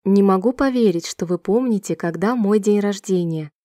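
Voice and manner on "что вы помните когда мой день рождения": ordinary talk, nothing marked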